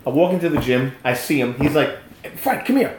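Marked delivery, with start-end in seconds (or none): 2.4-2.9 higher-pitched eager summons; imitated excitement. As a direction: beckon as the excited friend. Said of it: "He's all excited".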